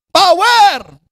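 high, loud rallying cry; shouting. Acted. Recruit yelling out at Hampton Garden, shouting. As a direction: call it out across a garden. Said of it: "yung pag sumisigaw ka".

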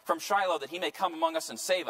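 high, loud acted protest and rallying call; elders' dismayed outcry. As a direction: cry out as baffled elders rallying the troops. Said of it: "The elders of Israel said".

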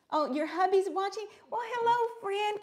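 high, sing-song hello; delighted greeting. Real, not acted. Crafter greeting a viewer's watching husband, high and cheery. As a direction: welcome him in warmly and excited.

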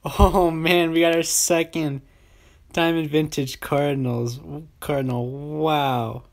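loud, thrilled outburst; excited amazement. Real, not acted. Gamer pulls a second diamond vintage card, loudly amazed. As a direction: exclaim loudly at a lucky pull.

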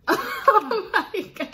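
slow, high laughing oh my God. Real, not acted. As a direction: drag it out while laughing. Sees co-host sweating.

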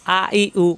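আ, ই, উ said quick and crisp; vowel drill. Shown as a demonstration. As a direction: drill আ ই উ briskly. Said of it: "পেশ আসলে উ".

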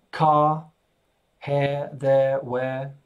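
"car", "hair", "there", "where" each ending on an open vowel, no R; non-rhotic "car", "hair", "there", "where", said one by one; R cut out. Shown as a demonstration. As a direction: drop the final R in "car", "hair", "there", "where", English style. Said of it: "just cut out the R".

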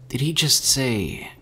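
stunned, unfinished question; trailing off. A narrator's reading. Herschel grasps the radio message, trailing off. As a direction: let the question hang open. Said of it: "Herschel trailed off".

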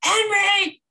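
high, loud sharp call of the dog's name; mean dog mom voice. Retold or imitated. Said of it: "the mean dog mom voice".

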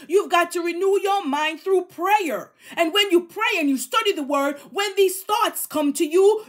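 usual speaking voice

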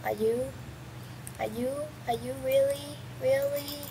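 quiet, suspicious questions to an opponent; hushed wary questioning. Pretend. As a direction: softly wonder as in a standoff. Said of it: "staring at each other like".

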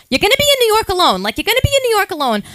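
high, fast fretting mother voice; worried mom. Retold or imitated. Mom fussing over a boy traveling alone, high and fast. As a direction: fret like an anxious mom. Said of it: "So she was like".

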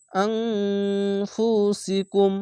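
আং held in a long nasal hum before ফু সি কুম; ikhfa ghunna. Shown as a demonstration. Teacher models the hidden n, long nasal hum. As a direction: hum through আং, then glide into ফু সি কুম. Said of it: "ইকফা গুন্না".

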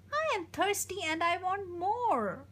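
a hint of a demanding toddler; baby voice. A narrator's reading. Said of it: "But the baby said".

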